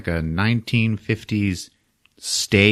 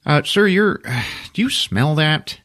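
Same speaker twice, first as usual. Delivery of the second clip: sassy retort; 7 semitones higher than usual. Retold or imitated. Mocking retort.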